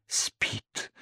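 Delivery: a soft breathy whisper. Acted. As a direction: whisper so as not to wake him. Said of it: "прошептала незамужняя тётушка". (тихий шёпот)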